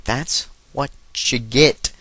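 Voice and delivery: word-by-word, stretched-out gloating; slow, pointed taunt. Acted. Gamer taunting a burned opponent, slow deliberate repeat. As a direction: space out each word for emphasis.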